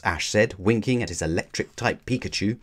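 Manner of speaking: ordinary delivery, nothing marked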